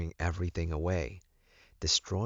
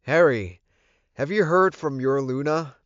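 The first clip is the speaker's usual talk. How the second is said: raised voice, pitched higher, calling after him; 9 semitones higher, 10 dB louder than usual. A narrator's reading. Alpha calling out to the departing gamma. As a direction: call him back before he leaves. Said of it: "I called out to him".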